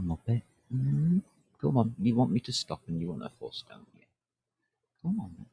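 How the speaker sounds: usual speaking voice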